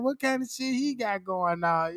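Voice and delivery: usual speaking voice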